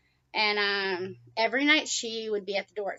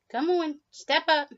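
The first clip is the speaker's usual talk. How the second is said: high, louder coaxing call; 8 semitones higher, 5 dB louder than usual. Real, not acted. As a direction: urge the bird up brightly. Coaxing bird onto hand.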